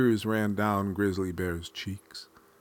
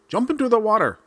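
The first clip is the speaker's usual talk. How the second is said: high, loud command to jump; shouted order; 12 semitones higher, 8 dB louder, much faster than usual. Acted. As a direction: bark the order much higher and louder. Raccoon orders bear into water.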